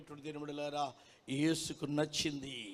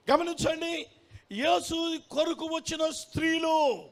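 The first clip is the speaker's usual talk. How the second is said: emphatic preaching; 10 semitones higher, 7 dB louder than usual. Acted. Preacher pointing to women who came for Jesus, emphatic preaching. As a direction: call the congregation to attention.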